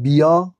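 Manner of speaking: «بیا» with a short i before the ya. Shown as a demonstration. Teacher showing the vowel shortening, «بیا» said with short i.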